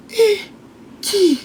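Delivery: high, mock-innocent question; feigned surprise. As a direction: imitate someone playing clueless. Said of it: "elle fait ça".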